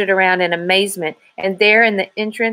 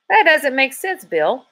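objecting; 7 semitones higher than usual. A narrator's reading. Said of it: "Dave objected".

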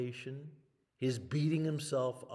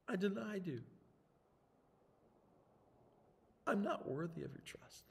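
higher, subdued shame; ashamed confession; 10 semitones higher than usual. Acted. Peter's guilty confession.

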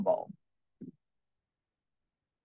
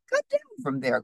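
mildly raised scolding cry; 9 semitones higher than usual. A narrator's reading. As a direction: call out an order to naughty kids. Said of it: "cried Fox".

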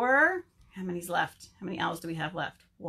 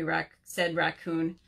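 ordinary delivery

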